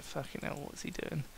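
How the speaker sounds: unvoiced shocked whisper. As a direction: hiss it under your breath. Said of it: "You're gonna just be like".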